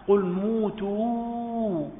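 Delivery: قل موتوا with the vowel held very long and slow; lengthened vowel. Shown as a demonstration. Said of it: "قالوا إشباع".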